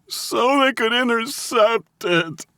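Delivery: high, drawn-out tearful wail. A narrator's reading. Captive finally confesses to mob boss, wailing. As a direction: wail it out in despair. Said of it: "Kittinger wailed".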